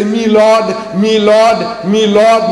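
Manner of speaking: a hint of a posh English courtroom "Me Lord". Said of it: "The Englishman is talking about the magistrate".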